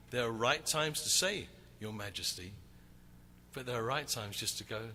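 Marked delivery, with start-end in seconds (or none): 1.8-2.5 hushed reverence. Retold or imitated. Formal reverent address to royalty.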